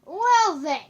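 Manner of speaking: very loud, high swoop; startled yell. Real, not acted. Gamer caught out in the game, loud yelp. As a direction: yelp it high, then drop.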